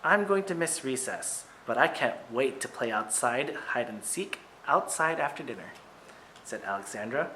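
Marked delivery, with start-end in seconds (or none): none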